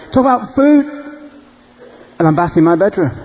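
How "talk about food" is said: high shouted cry; waking up mid-shout. Retold or imitated. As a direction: yell it out of a dream. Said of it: "I'm in mid-shout".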